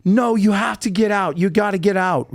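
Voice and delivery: freaking out. Retold or imitated. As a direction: act out someone freaking out and ordering it gone. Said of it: "freaking out".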